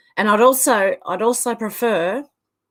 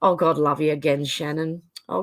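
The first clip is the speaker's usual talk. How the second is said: ordinary delivery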